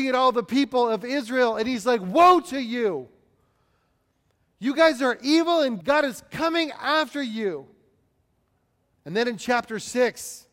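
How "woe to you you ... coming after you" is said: loud, higher prophet's outcry; raised, emphatic condemnation; condemning. Acted. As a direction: thunder a warning at the crowd. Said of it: "some very condemning statements".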